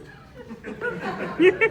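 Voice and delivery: quick high laugh; a short burst of laughter. Real, not acted. Narrator laughing at Lyndon's thought.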